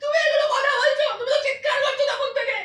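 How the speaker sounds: loud, high, real crying; tearful accusation. Real, not acted. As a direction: throw the blame back through sobs. Crying wife accuses husband in quarrel.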